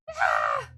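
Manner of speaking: sharp pained ah after a bump; pained cry. Real, not acted. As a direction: cry out from the knock. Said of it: "I ran my knee into my door so hard".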